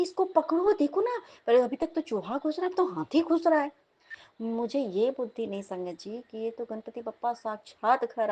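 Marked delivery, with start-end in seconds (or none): 0.0-3.7 high, agitated plea; urgent alarm. Retold or imitated. Wife begging husband to hold the window, alarmed. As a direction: urge someone to act, pitch raised throughout.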